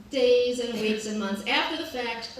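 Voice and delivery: ordinary talk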